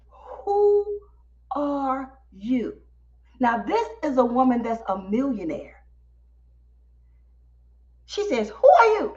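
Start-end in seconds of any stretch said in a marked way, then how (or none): 0.1-2.8 retold amazement, each word set apart; slow, stunned question with big pauses; astonished. 8.5-9.1 high, loud burst in one breath; retold amazed demand, run together; astonished. Astonished host, retold again.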